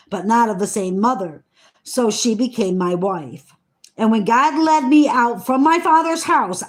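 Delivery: measured, solemn Bible reading voice; scripture reading. Acted. Reading Genesis to her listeners, in a solemn reading voice. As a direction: read the old words with weight and care.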